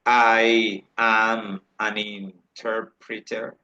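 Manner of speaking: drawn-out, syllable-by-syllable demonstration; slow model sentence. Shown as a demonstration. Teacher models "an" before a vowel for students, very slowly. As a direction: break it up very slowly for learners.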